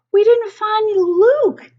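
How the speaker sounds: high, loud, slow anguished yell. Acted. Dan yelling to the chief, high loud yell. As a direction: cry out that Luke is lost. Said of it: "yells Dan".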